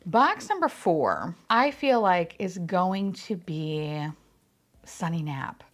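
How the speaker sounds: ordinary delivery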